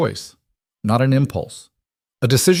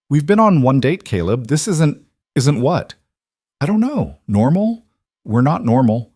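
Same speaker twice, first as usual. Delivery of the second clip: agitated; 6 semitones higher, 4 dB louder than usual. Acted. Mara pushes back, upset.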